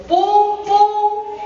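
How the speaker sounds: the wolf pounding on the door; knocking sound. Acted.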